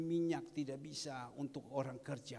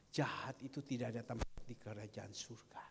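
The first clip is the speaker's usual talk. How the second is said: ordinary talk, nothing marked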